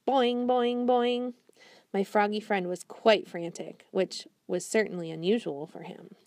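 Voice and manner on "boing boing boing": higher, louder bouncing boings; boing sounds. Acted. Frog jumping noise.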